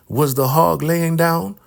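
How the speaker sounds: mock elder woman's high voice; high-voiced questioning elder. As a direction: interrogate like a suspicious elder. Elder Mother questions about the hog.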